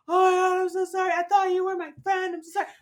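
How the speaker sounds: high, loud, flustered imitation; apologetic teen. Imitating a teenager who scared a kid, frantic apology. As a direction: gush a panicked mock apology.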